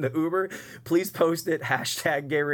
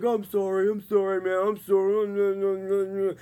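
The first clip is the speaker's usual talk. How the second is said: higher, slow, drawn-out mock apology; overly apologizing; 6 semitones higher, slower than usual. Drunk passenger apologizing to the driver, hammed-up sorry. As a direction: grovel sorry in a drunk bro voice. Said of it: "overly apologizing".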